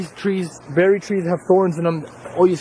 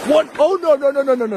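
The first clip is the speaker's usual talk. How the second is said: almost slipping, frightened; panicked; 7 semitones higher than usual. Real, not acted. Almost falls in river.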